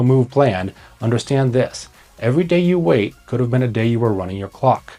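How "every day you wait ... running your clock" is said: usual speaking voice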